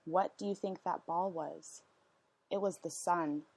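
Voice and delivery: plain reading voice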